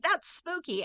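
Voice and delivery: mock spooked. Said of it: "she's like".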